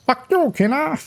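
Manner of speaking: high, laughing villain sneer; cartoon villain voice. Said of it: "his cartoon villain voice".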